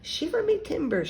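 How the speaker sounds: high-pitched pirate exclamation; mock shout, pitched right up. A narrator's reading. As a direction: proclaim it like a little pirate. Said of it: "she shouted".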